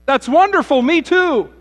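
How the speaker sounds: high, loud gushing delight; thrilled to find a fellow believer; delighted rescuer. Acted. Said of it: "The man said".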